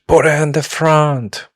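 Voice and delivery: high, loud attempt at a Scottish accent. Retelling Ramphis's line in a put-on Scottish accent. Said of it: "he says with his Scottish accent".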